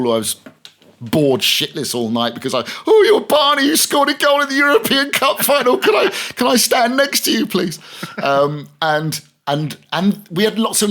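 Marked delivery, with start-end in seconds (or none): 2.9-7.8 high, loud starstruck fan voice; mock starstruck fan squeal; gushing fan. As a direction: gush like an overexcited fan pestering a footballer. Fan pestering footballer at party.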